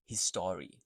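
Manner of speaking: "history" in three syllables, his-STORY; over-pronounced history. Shown as a demonstration. Teacher showing learners what not to say, "history" over-pronounced. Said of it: "So you don't say".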